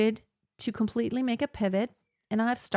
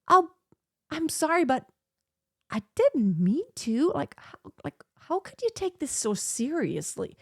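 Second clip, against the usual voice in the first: mock defensive apology, higher and breaking into a hushed voice; 6 semitones higher than usual. Someone defensively apologizing to a hurt friend, mock defensive. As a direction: play the defensive one brushing off hurt. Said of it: "get all defensive".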